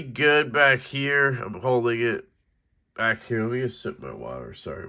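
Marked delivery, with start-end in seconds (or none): none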